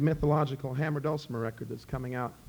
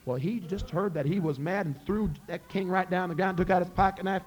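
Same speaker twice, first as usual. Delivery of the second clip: plain reading voice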